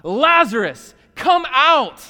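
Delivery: loud, rising shout to raise the dead; full-force acted scream; screamed command. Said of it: "Jesus screams out".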